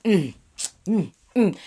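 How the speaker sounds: ordinary talk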